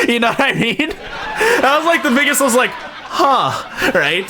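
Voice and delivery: real high laughter breaking through the words; laughing. Real, not acted. Telling chat the story and laughing hard at it. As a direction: laugh it out, voice jumping up. Laughing over the funny reveal.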